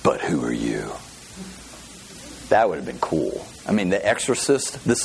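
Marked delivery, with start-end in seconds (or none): 0.0-0.9 deeper evil spirit voice. Pretend. Preacher redoing the evil spirit deeper for the church. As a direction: sink lower still, a menacing spirit. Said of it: "It needs to be more like that".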